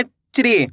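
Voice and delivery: च्री said as one syllable, ch joined to ri; teacher modelling च्री; pronunciation demo. Shown as a demonstration. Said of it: "चा का उच्चारण कैसे करेंगे".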